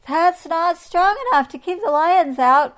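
high, loud warning call. A narrator's reading. Monkeys warning Anteater from the trees, calling out. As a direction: call it across loudly. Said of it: "called the monkeys".

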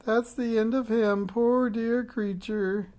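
high, loud, slow wailing witch; howling grief. A narrator's reading. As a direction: wail over her lost cat. Continues the howl.

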